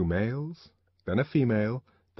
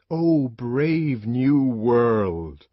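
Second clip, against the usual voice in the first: slow, louder commanding proclamation; challenging cry; 3 semitones higher, 6 dB louder, much slower than usual. Acted. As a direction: throw it down as a command. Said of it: "It was a challenge, a command".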